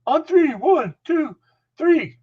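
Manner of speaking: high, strained coach yell; Dick Adams. Retold or imitated. Coach running the freshman through drills, exasperated high yelling. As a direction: yell the count, then scold the player. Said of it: "You hear him go".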